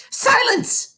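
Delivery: very high shrill shout. A narrator's reading. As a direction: headmistress screaming at the giggling audience. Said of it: "the Trunchbull shouted".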